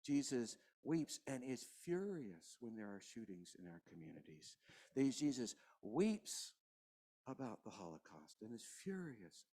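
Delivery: preacher quietly mourning with the congregation; hushed grieving preaching. Real, not acted. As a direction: let the grief sink the voice down. Quiet grieving sermon close.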